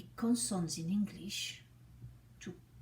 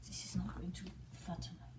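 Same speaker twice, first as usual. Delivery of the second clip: quick whispered aside. Real, not acted. Puppeteer whispering an aside. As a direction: mutter it under your breath.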